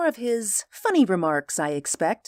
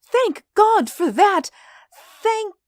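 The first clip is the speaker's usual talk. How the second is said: put-on high, loud, slow old-lady cry; fervent relief cut short; 7 dB louder, slower than usual. A narrator's reading. Old mother thanking God, relieved until she stops short. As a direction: cry it out, then break off suddenly. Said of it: "She broke off suddenly".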